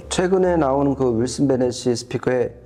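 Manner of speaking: ordinary talk, nothing marked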